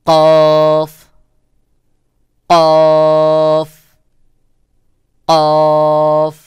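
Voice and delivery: কফ very loud, heavy and round, drawn out; loud emphatic Arabic কফ, hollow vowel; hard, rounded কফ. Shown as a demonstration. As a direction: hit a hard back-of-throat কফ and hold it. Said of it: "কফের আওয়াজ শক্ত করে".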